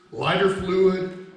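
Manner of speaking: ordinary talk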